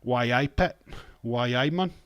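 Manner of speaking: mock Newcastle accent, rising; hammed-up Geordie greeting. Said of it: "It's like your Newcastle".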